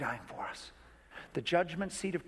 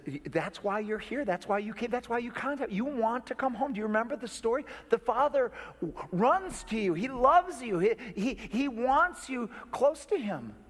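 rising higher and louder, pleading urgently; impassioned plea; 7 semitones higher, 3 dB louder than usual. Retold or imitated. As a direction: press the love of the Father on her, building. Pastor pleading with crying woman.

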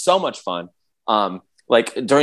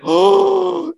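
1 awed gasp; 3 dB louder than usual. Retold or imitated. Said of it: "and I was like".

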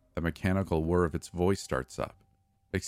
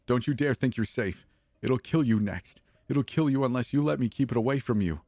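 high, fast frantic warning; wild desperate warning; 6 semitones higher than usual. A narrator's reading. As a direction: talk him down in a panic. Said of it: "Her eyes are wild again, desperate".

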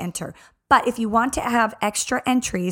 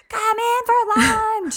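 put-on high-pitched holler calling kids in; imitated mom yell; 12 semitones higher, slower than usual. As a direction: call out like a hoarse mom across a beach. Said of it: "so I'm, like, yelling".

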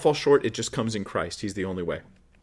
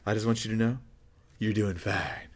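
flattering false prophet. Retold or imitated. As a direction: coax them gently, a hint of syrupy reassurance. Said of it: "They go".

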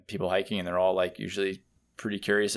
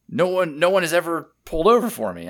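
higher and louder, amazed and thankful; grateful surprise; 9 semitones higher, 7 dB louder than usual. Retold or imitated. Hiker to the rider, amazed, higher and louder thanks. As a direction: gush like nobody was ever this kind. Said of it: "He's like".

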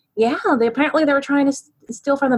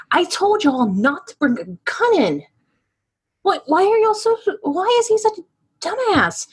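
exasperated scolding; 8 semitones higher than usual. Acted. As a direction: pitch climbing high in disbelief. Prisoner scolding Brett.

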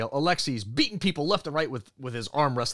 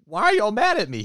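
baffled dad; 8 semitones higher, 5 dB louder than usual. Retold or imitated. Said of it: "he's like".

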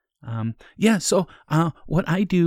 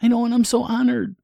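much higher, sweet eager tone; high innocent voice; 10 semitones higher than usual. Acted. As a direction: squeak out your gratitude.

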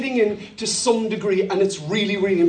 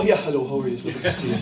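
much lower and softer, a distant hello; stiff cold greeting; 10 semitones lower, 6 dB quieter, much faster than usual. Acted. Pastor acting a standoffish reply to an offered handshake, low and quiet. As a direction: pull back and mumble a cool hello. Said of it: "It's kind of incongruous".

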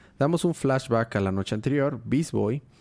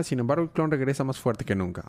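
ordinary talk

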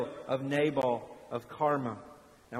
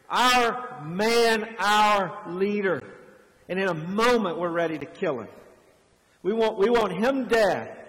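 louder, rising preacher at full force; fervent preaching; 2 semitones higher, 3 dB louder than usual. Acted. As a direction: build it up and press it on the congregation.

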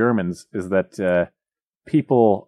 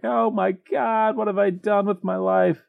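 high, slow, mock-anguished Scrooge; hammed-up despair; 11 semitones higher than usual. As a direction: overplay a miser's big regret moment. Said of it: "that's the big moment in the story".

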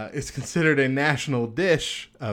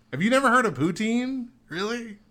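mock disbelief; 10 semitones higher than usual. Real, not acted. Host teasing co-host who never heard of poutine, mock disbelief. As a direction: pitch jumps way up in mock shock.

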